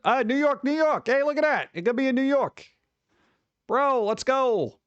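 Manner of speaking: high, delighted New York cheer; thrilled to find a New York date; excited. Real, not acted. Streamer thrilled to find a New York show, excited.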